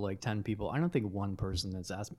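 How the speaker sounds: ordinary talk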